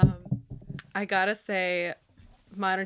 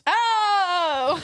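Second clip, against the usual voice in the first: surprised yelp; 11 semitones higher, 7 dB louder than usual. Real, not acted. Reacting to hot butts segment.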